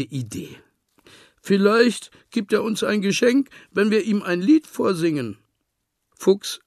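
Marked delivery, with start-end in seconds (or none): 1.4-5.4 hopeful. A narrator's reading. Piglet's hopeful idea.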